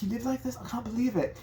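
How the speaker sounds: lightly high British lady voice; half-hearted British disbelief; British woman disgusted, mildly high. Retold or imitated. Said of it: "She's, like, British".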